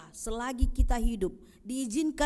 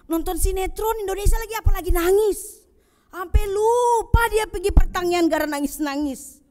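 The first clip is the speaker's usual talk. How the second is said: teasing build; 9 semitones higher, 6 dB louder than usual. Acted. Mocking people crying at TV.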